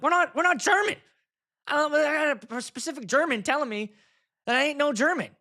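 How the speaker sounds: high-pitched frantic retelling; hammed-up, high-voiced distress; mock panic. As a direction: break the news in comic alarm. Retelling panicked call to Amy.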